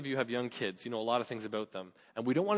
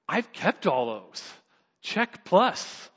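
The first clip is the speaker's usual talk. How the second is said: smug boast; 4 semitones higher, 5 dB louder, much slower than usual. Retold or imitated. Rich young man boasts to Jesus.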